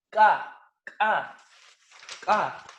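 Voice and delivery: loud, sharply popped K; velar K demo. Shown as a demonstration. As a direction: bark out a hard K from the back of the mouth. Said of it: "que surgen en la zona bucal velar".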